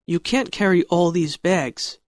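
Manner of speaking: ordinary delivery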